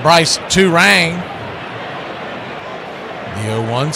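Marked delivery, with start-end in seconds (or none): none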